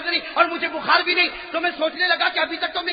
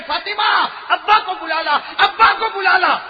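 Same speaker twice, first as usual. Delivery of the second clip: pleading cry; 5 semitones higher, 6 dB louder, slower than usual. Acted. Ali pleading loudly.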